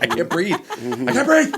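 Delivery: mock breathless panic. CrossFitter imitating herself mid-workout with bad anxiety, mock panic.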